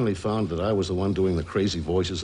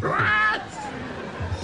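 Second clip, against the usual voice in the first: parrot squawk. Retold or imitated. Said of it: "talk like a parrot".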